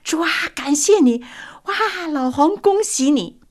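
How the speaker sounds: high, loud happy congratulation; joyful loud praise. Retold or imitated. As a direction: cheer him like a delighted crowd. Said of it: "高声说".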